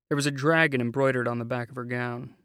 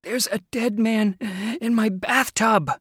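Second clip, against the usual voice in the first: louder, high, stress on dead; vehement gulping cry; 9 semitones higher, 6 dB louder than usual. Acted. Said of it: "Foster gulped out more vehemently".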